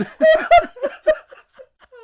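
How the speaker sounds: howling laugh, near tears; guffaw. Real, not acted. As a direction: laugh till you almost cry. Cracking up at sexy dinosaur.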